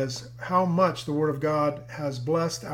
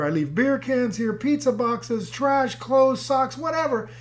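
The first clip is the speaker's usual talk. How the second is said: acted enthusiasm; 7 semitones higher, slower than usual. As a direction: list climbing higher item by item. Husband's excited list acted out.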